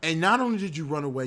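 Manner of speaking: usual speaking voice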